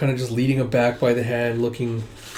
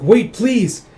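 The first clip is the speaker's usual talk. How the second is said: high, louder acted plea; urgent plea; 9 semitones higher, 7 dB louder than usual. Woman begs the departing prince, urgent plea. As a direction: beg him to stop.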